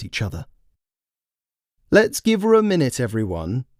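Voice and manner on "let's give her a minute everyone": calm the crowd with firm authority; Sue commanding. Acted. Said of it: "Sue commanding".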